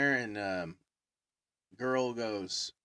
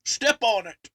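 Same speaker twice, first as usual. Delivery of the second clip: mock order; 10 semitones higher, 6 dB louder than usual. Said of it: "He goes".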